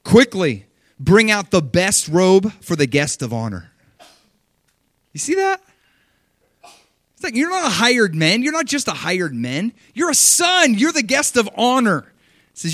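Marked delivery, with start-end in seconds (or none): 0.0-3.7 loud, slow urgent order from the father; urgent father command. Acted. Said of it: "Then he says this". 5.1-12.1 loud, high preacher's exhortation; preaching at full force. Real, not acted.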